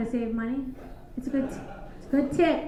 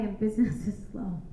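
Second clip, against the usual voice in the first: drops to a breathy whisper; sultry whisper; 4 semitones lower, 9 dB quieter than usual. Acted. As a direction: fade into a teasing whisper. Sultry punchline, dropping to whisper.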